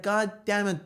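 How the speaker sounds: normal talking voice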